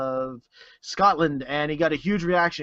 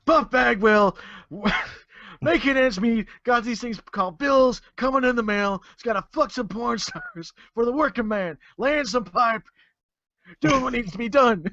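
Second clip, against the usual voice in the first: high, hammed-up wrestler promo voice; Buff Bagwell impression; 6 semitones higher than usual. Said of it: "I feel some Austin coming on".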